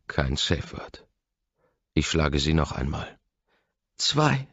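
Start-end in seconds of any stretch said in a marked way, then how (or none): 4.0-4.5 high, loud cry of the count; pained cry. A narrator's reading. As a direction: cry out the number in pain. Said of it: "schreit sie".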